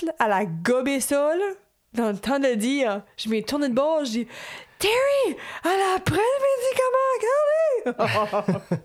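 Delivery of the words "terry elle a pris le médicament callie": high, loud panicked yell across the room; shrieking for her partner in alarm; panicked shout. Retold or imitated. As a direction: scream it out in a sudden panic. En panique.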